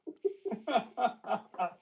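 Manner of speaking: high, helpless laughter. Real, not acted. Remembering the prank, high helpless laughter. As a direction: laugh until the ribs hurt.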